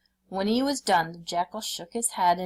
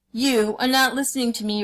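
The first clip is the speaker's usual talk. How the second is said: louder tiger roar, raised high; 5 semitones higher, 6 dB louder than usual. A narrator's reading. Tiger roars at the jackal who won't listen. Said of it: "roared the tiger".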